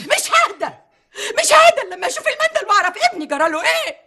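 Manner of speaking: tearful refusal. Acted. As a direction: refuse to calm down through tears. Mother refuses to calm, sobbing.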